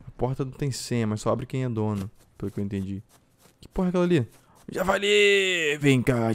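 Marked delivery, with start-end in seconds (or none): 4.7-6.3 drawn-out high call, slow; long slow call at the boar. Real, not acted. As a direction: stretch the word out as you hail the boar. Spots a boar, calls it.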